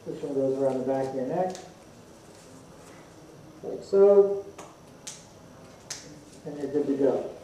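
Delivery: muffled behind face mask; speaking inside half-face respirator; through a respirator mask. Real, not acted. Seen 0: Respirator covers mouth and nose throughout while he straps it on.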